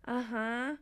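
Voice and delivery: soft, drowsy assent; sleepy. Acted. Phoebe barely listening to Monica, sleepily. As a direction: murmur it half asleep. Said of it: "Phoebe sleepily goes".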